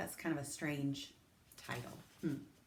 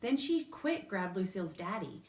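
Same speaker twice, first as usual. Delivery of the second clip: usual speaking voice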